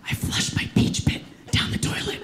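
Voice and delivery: hushed guilty whisper. Retold or imitated. Mom confessing the peach pit to her son, whispered. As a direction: whisper it like a secret. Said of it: "Why are you whispering about that".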